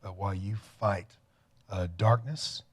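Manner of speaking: ordinary talk, nothing marked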